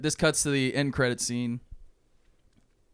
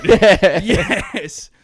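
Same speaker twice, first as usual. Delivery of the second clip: much higher, much louder mock-excited cry; excited awe; 7 semitones higher, 13 dB louder than usual. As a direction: blurt it like a thrilled fan. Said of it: "they're just like".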